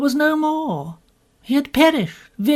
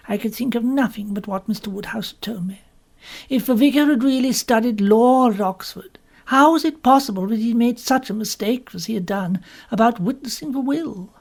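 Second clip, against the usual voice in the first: ordinary delivery, nothing marked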